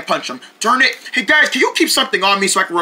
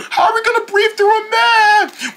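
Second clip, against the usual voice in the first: higher frantic whine; high panicked squeak; 12 semitones higher than usual. Acted. Deerling frets about masks in a high squeal. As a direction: squeal the worry.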